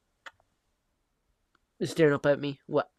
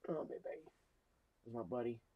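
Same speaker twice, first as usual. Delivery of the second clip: soft cooing; 11 dB quieter than usual. Real, not acted. Cooing softly to the cat.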